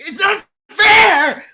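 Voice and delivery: very high, slow, drawn-out whiny shout; mock tantrum shout. Millennials complaining alone at home, whiny shouted wail. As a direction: wail it like a sulking kid at home. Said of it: "just being like".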